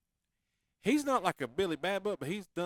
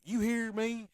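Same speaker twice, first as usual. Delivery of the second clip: louder, higher, casual "you hear me"; playful catchphrase; 5 semitones higher, 5 dB louder, much slower than usual. Shown as a demonstration. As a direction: toss it out loud and country. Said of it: "like how we do it".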